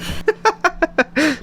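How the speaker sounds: high-pitched burst of laughter; squeaky high laugh; high giggle. Real, not acted. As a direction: giggle at your own confession. Laughing at own snitching.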